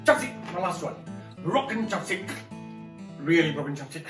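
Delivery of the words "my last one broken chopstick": plain reading voice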